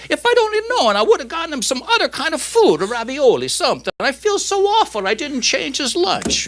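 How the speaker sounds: very high, lamenting widow voice; comic falsetto grief; Italian wife. Acted. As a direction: wail with hammed-up regret as an Italian wife. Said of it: "the Italian wife says".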